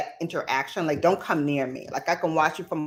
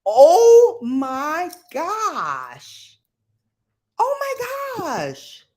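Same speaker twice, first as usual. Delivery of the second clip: very slow, louder, higher "oh my gosh" in disbelief; shocked drawn-out gasp; 13 semitones higher, 8 dB louder, much slower than usual. Real, not acted. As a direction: stretch it out in horror. Viewer split his tongue.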